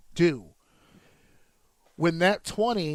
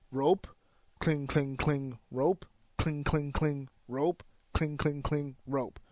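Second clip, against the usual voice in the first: even, unhurried "cling, cling, cling" between each "rope"; steady natural cadence; 3 dB quieter, slower than usual. Shown as a demonstration. Trainer showing natural rope running in a twenty, steady. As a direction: keep "rope, cling, cling, cling" regular as breathing. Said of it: "There's a consistency there".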